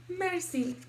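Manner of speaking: high, dainty French thank-you; curtsying fancy girl. Acted. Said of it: "I curtsy and say".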